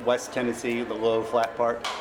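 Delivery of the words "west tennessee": ordinary delivery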